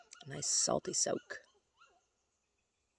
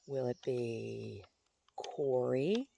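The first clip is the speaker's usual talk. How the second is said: slow question rising on Corey; slow, wondering aloud; musing question; much slower than usual. Real, not acted. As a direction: draw out the choice, lifting at the end. Musing aloud over a choice.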